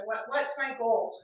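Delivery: ordinary delivery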